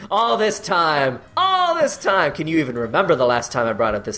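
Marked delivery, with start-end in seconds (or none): 0.1-1.2 slow, high hammed-up villain gloat. Gloating villain over top hat. 1.3-2.3 "all this time" said much higher, drawn out; much higher repeat. Pretend. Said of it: "repeated higher".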